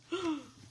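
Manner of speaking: a little theatrical gasp; mock gasp. Teacher reacting to the picture, mock gasp. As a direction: gasp for the kids' benefit.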